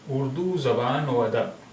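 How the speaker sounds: اردو زبان و ادب slowly with و as "o"; slow pronunciation demo. Shown as a demonstration. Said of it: "اس کا پروننسیشن ہو جائے گا او". (آہستہ تلفظ، و کو "او" بول کر)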